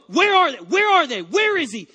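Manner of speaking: loud, high demanding shout; sleepy angry demand. Retold or imitated. Husband half asleep on honeymoon, loud angry demand. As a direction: bark it like a man robbed of his flowers. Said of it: "and I go".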